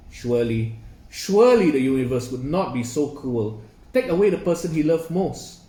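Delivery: usual speaking voice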